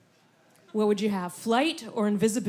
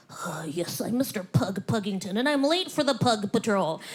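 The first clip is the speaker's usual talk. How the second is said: pug voice; 2 semitones lower, 3 dB louder than usual. Retold or imitated. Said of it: "try to do his voice".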